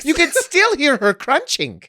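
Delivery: high, loud eerie punchline; hammed-up ghost-tour storyteller. Comic ending Matilda's Cheetos tale, hammed-up storyteller. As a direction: deliver the silly haunting with mock gravity.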